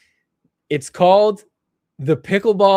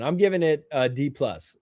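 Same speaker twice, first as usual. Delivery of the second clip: ordinary delivery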